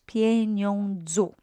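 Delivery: "Pieniądzu" said slowly and clearly; slow model word. Shown as a demonstration. Teacher repeating the Polish form, slow and clear. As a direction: draw out "Pieniądzu" for the learners.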